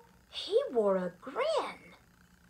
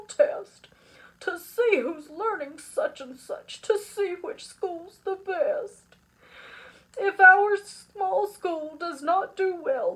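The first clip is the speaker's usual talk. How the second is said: hammed-up tearful announcement; sobbed announcement; 2 semitones higher, 4 dB louder than usual. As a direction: deliver the bad news as a mock sob. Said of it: "then sobbed".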